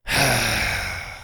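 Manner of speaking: long exhaled sigh; drawn-out, breath-heavy sigh. Acted. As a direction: heave a weary sigh. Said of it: "Kirby heaved a sigh".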